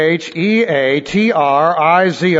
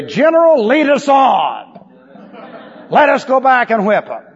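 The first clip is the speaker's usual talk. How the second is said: rallied soldiers; 5 semitones higher than usual. Retold or imitated. Shamed soldiers to their general, imitated rallying cry. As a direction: imitate stung troops begging to fight. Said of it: "embarrass his men so much they'd just say".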